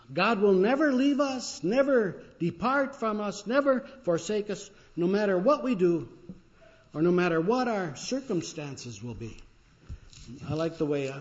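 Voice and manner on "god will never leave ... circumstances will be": preaching with rising force. Acted.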